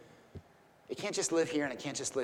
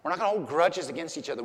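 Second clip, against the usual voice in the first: ordinary delivery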